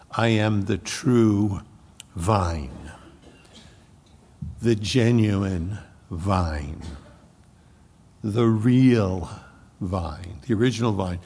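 slow, weighty words with long pauses between; emphatic. Shown as a demonstration. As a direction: lean on true, genuine and real, one by one. Stressing the true vine, slowly.

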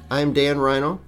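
ordinary talk

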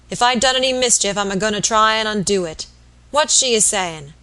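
loud rustic sick man, quarrelsome; savage invalid, defiant. Acted. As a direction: protest loudly from the sickbed. Sick man's character voice.

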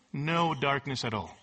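low, matter-of-fact statement; understated answer. Shown as a demonstration. Preacher answering his own question about a Greek word, understated. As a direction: drop the pitch and state it simply.

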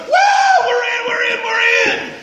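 high, whooping celebration; joyful shouting. Retold or imitated. Players phoning home that they made the team, joyful shouting. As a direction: whoop it out, bursting with joy. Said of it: "joy and thankfulness is not dignified".

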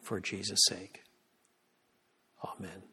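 measured prayer with long pauses; solemn public prayer. Real, not acted. Preacher praying for a small assembly, solemn and earnest.

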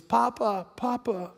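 soft, plaintive call for grandpa; understated tearful child imitation; imitated crying child. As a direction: mimic a hurt toddler reaching for comfort. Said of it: "crying and crying".

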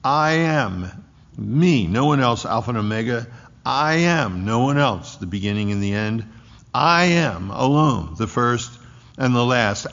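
weighty stress on "I am", a falling "no one else"; emphatic I AM. Shown as a demonstration. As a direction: drive home each "I am", with "me" and "alone" hit hard. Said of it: "it's emphatic".

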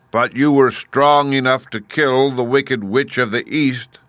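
loud, high, very slow challenge; imperious, drawn-out giant voice; booming Great Head. A narrator's reading. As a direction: boom it slowly like a giant oracle.

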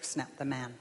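usual speaking voice

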